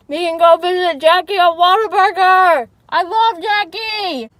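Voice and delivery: loud, high and excited. Real, not acted. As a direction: burst out with excitement. Excited shout about friend.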